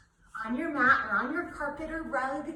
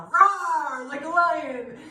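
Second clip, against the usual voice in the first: roaring words; 4 semitones higher, 9 dB louder, much slower than usual. Shown as a demonstration. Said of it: "teacher says during exhale".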